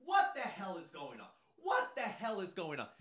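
high-pitched outraged shriek; mock hysterical yell; yelling woman. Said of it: "she starts yelling".

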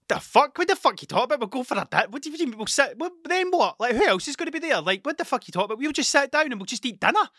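high-pitched, incredulous outburst; acting out stunned confusion at an invitation; baffled protest. Acting out his baffled younger reaction.

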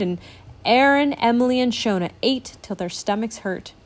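ordinary delivery

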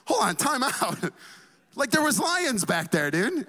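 high-pitched, mock-alarmed objection; incredulous protest. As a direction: object like you can't believe the driver. Acts his protest to driver.

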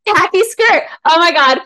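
high, loud mock outrage; mock scandalized. Fan reacting to Bella's khaki skirt, mock scandalized. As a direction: exclaim it as if utterly scandalized.

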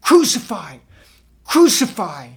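loud, high mob shout. A narrator's reading. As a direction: yell it like an angry crowd. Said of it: "they shouted".

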